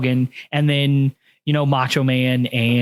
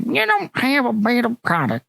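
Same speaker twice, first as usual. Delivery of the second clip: a higher mocking voice on the retort; [name] imitating Ted Turner; 7 semitones higher than usual. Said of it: "his Ted Turner impersonation".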